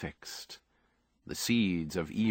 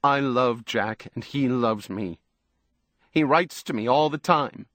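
fierce insistence, rising higher and louder; defiant, emphatic protest; 4 semitones higher, 4 dB louder than usual. A narrator's reading. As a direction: insist fiercely, leaning hard on the words. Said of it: "said fiercely".

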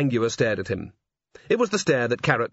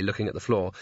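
usual speaking voice

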